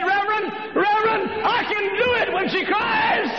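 high shouted tearful cry; high emotional shout; husband breaking down. Acted. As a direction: call out to the reverend in tears.

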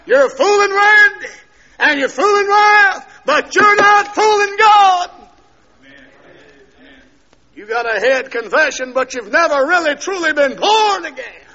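preacher shouting at the pews that God is not fooled; preaching at full force. Acted. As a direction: drive it home to the congregation. Preaching at the congregation.

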